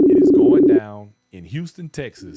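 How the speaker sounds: loud hype announcement, rising; big excited party-host call; hype shout. Real, not acted. Hyping upcoming Houston event.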